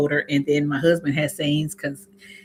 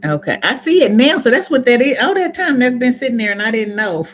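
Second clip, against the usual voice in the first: surprised realization; 7 semitones higher, 8 dB louder than usual. Real, not acted. Suddenly notices screen item.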